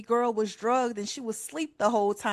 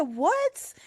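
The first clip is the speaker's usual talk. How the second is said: much higher startled mock-mom question; a mother caught off guard, pitched way up; mom surprised; 10 semitones higher than usual. Mom hearing [name] died, high startled question. Mom voiced in retold story.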